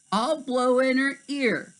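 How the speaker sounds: high playful giraffe; high, sneaky animal plan; high mischievous voice. Acted. Giraffe plots.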